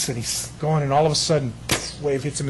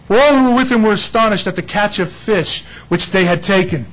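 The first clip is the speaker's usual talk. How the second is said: louder, higher, slow preaching build; full-force preaching; 5 semitones higher, 7 dB louder, slower than usual. Acted. Preacher stirring the congregation, full force. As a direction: preach it out to the room.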